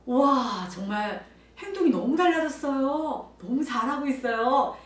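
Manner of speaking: high, loud, drawn-out gushing praise; imitating admiring agreement. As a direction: chime in as an impressed fellow teacher. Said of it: "우리 선생님이 맞장구 쳐주거든요". (맞장구치며 크게 칭찬하는 말투)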